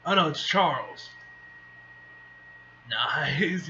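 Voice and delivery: ordinary delivery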